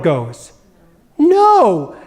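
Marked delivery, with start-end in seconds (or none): 1.2-1.9 put-on high, loud child's refusal. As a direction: blurt it like a scared five-year-old. Five-year-old refusing funeral.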